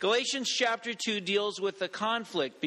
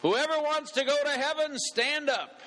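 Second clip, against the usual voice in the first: high-pitched rousing pulpit call; preacher's command; 8 semitones higher than usual. Acted. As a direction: command the congregation like a fiery preacher. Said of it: "the minister commanded".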